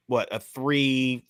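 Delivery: ordinary talk, nothing marked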